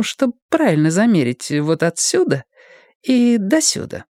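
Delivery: put-on high teasing girlish voice; coy, playful and provocative.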